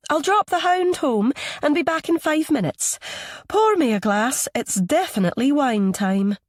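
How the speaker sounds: breezy, refined Scottish woman calling through; posh Scottish accent. A narrator's reading. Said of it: "Her accent is Scottish, posh".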